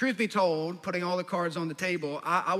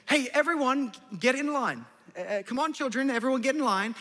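teacher calling out; 6 semitones higher than usual. Retold or imitated.

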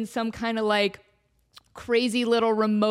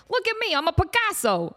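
Mr. Potato Head; 8 semitones higher than usual. Acted.

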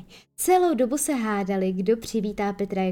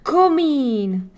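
higher, louder shout; shouted discovery; 4 semitones higher, 6 dB louder than usual. A narrator's reading. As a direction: blurt it out together. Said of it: "vykřikli skoro současně". (vykřiknutí)

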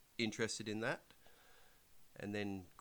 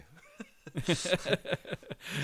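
burst of laughter; 10 semitones higher, 9 dB louder than usual. Real, not acted.

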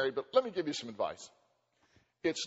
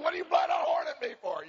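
high mock shout at her husband; angry wife; 9 semitones higher, 3 dB louder than usual. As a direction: yell it like the ticked-off wife. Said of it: "she said".

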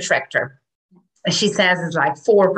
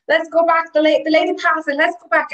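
cheery drivers; 9 semitones higher than usual. Retold or imitated.

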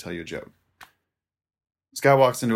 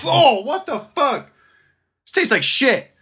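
much higher, louder, slow outraged yelp; disgusted customer; 8 dB louder than usual. Acted. Customer biting the apple, disgusted outrage. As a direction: spit it out in horror.

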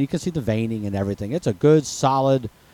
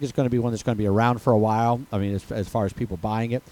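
normal talking voice

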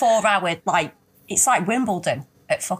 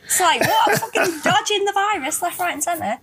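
laughing disbelief; 9 semitones higher than usual. Real, not acted. Incredulous rant about virus rules.

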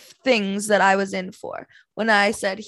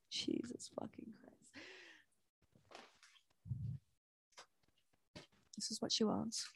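breathless exasperated whisper; annoyed whisper; real whisper; 3 semitones higher, 23 dB quieter than usual. Real, not acted. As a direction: hiss it under your breath so nobody hears. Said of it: "I'm trying to whisper".

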